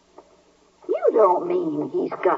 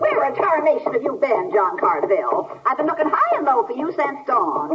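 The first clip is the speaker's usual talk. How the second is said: high-pitched scolding; an aunt berating the boy for vanishing; 6 semitones higher than usual. Acted. Aunt scolding her runaway nephew, angry and high. She looks madder than a wet hen.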